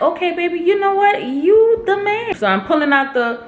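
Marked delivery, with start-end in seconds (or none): 0.0-2.3 high, gushing and relieved; relieved high praise. Retold or imitated. Wife gushing to husband after feeling better, much higher. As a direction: gush with relief.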